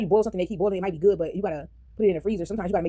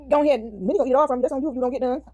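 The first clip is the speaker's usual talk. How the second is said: calling out; 6 semitones higher, 3 dB louder than usual. Real, not acted. Calling out to the dog.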